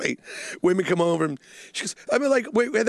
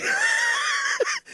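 shrieking laugh. Real, not acted. Laughing mid-story.

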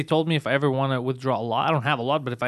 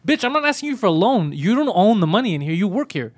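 higher and louder, a mock tirade at the teller; retold angry tirade; 5 semitones higher, 4 dB louder than usual. Friend acting out telling off the bank teller, angry. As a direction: tell off the nosy teller.

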